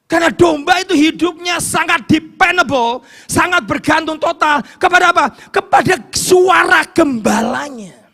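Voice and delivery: loud, high, fervent preaching; preaching at full force. Acted. Preacher warning the congregation against self-chosen paths, fervent shout. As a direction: drive the warning home to the congregation.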